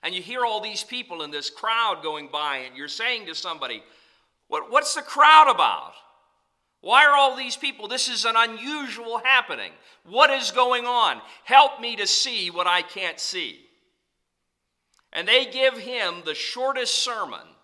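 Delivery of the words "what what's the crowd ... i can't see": high, loud urgent questions; anxious calling out. Retold or imitated. Preacher voices the blind beggar asking what is happening, urgent. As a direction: call out desperately over the crowd.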